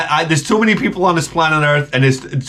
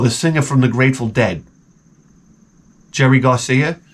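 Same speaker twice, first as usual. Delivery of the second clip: usual speaking voice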